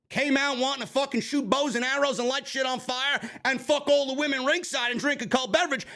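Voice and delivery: normal talking voice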